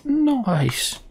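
high pleased exclamation falling away; a big happy nice; delighted nice. Real, not acted. Gamer delighted by a new ability, high and pleased.

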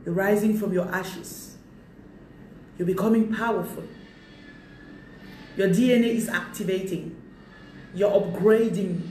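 slow, solemn prophecy; slow prophetic proclamation; prophetic declaration. Acted. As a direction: pronounce it slowly like a vision.

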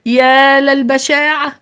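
high, drawn-out cry of bitter dismay. A narrator's reading. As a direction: wail it slowly in bitterness. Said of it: "وفي مرارة هتف القائد الأعلى".

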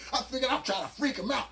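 ordinary talk

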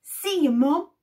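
loud, bright goodbye; cheerful call. Acted. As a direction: call it back as he leaves. Jack calling goodbye.

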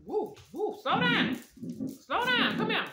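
high, excited whoops and orders; high-pitched calling. Real, not acted. Host slowing Tootie as she arrives, high-pitched calling.

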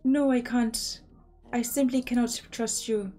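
mistrustful husband in an attempted Irish accent; shaky Irish accent. Husband back from jail accusing his wife, in a rough Irish accent. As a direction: put on Irish, accusing and a bit unconvincing. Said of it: "the Irish accent's not doing great".

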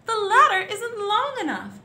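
high, loud protest; calling back. A narrator's reading. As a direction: call it out high to someone far below. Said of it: "the gardener called back".

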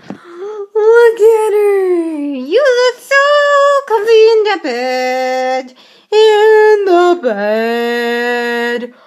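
put-on loud, high singsong baby talk; slow crooning pet voice; cooing at pet. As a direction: sing it slowly to the sleepy cat.

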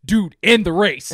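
exasperated. Retold or imitated. Viewer six minutes into the race, exasperated. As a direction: plead with the screen, fed up. Fed up with the long scene.

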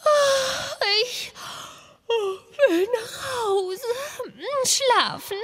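wailing slowly like a tired princess; whiny wailing. Acted. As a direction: moan about the sleepless night. Prinzessin jammert nach schlafloser Nacht. (Prinzessin jammert langsam und weinerlich nach der schlaflosen Nacht)

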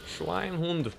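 high, soft mock German; bad German accent. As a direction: mutter it as a cartoon German. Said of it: "I can't do a German accent".

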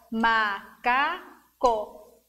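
"macaco" slowly, one beat per syllable; three stretched, separated syllables in "macaco". Shown as a demonstration. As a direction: tap out "macaco" as ma, ca, co. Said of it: "Tocou três vezes?".

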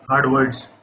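normal talking voice

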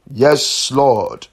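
ordinary talk

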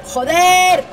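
panicked yelling. Real, not acted. Trapped, monster approaching.

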